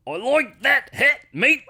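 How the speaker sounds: high, loud, drawn-out Aussie accent; Australian accent. Shown as a demonstration. Co-host demonstrates a trick for getting into the accent, hammed-up Australian. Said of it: "get into the accent is you say".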